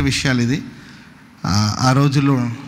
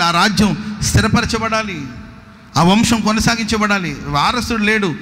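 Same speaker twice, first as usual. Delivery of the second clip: preaching at full force; 7 semitones higher than usual. Acted. Pastor preaching to the congregation about a king with no heir, at full force. Preacher builds dramatic opening.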